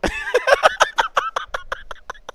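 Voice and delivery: very high squealing laugh; a long high squeal of laughter; shrieking laugh. Real, not acted. Narrator laughing at the punch, high squealing laugh.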